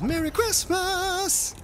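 very high, drawn-out jingle voice; high, slow novelty greeting; sound-machine voice. Pretend. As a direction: squeeze it out slowly like a toy sound button. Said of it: "pretend that I've got all high-tech equipment".

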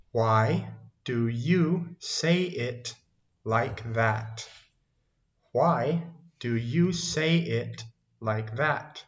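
very slow, stretched-out question; slow teaching model. Shown as a demonstration. Teacher models a longer question for learners, very slow and deliberate. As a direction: spell it out word by word for beginners.

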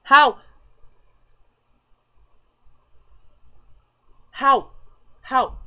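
loud, high-pitched disbelief; baffled outburst. Real, not acted. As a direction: blurt it out in shock, word by word. Baffled by sudden celebrity status.

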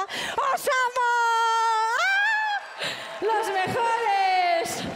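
high, drawn-out squeals of adoration; long, high-pitched fangirl cries; adoring fan scream. Acted. Fan screaming adoration at nominated team.

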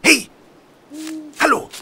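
shouted calls after a fleeing man; calling out. Acted. Holmes calls after a fleeing young man, loud shouts. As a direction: yell after him to stop.

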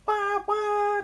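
very high, loud wailing toot; piercingly high double toot; imitated train whistle. A narrator's reading. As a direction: mimic a steam whistle blast.

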